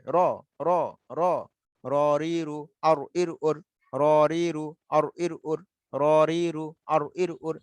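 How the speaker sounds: "র রি রু, আর ইর ওর" with a full, thick R; thick, heavy R. Shown as a demonstration. Teacher repeating ra for the class, thick heavy R. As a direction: make each "র" heavy, tongue turned back. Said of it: "হরফটা মোটা হবে".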